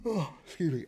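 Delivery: high, stretched through a yawn. Real, not acted. Sleepy vlogger apologizing through a yawn. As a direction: excuse yourself mid-yawn. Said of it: "I'm yawning again".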